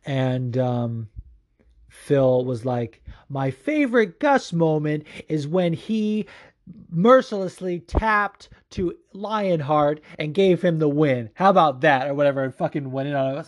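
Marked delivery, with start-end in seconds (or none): 3.3-12.1 slow, higher, emphatic taunt; imitated gloating rant. Phil answering a question about Gustafsson, gloating. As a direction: hit each word hard and slowly, rubbing it in.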